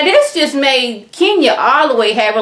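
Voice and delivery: usual speaking voice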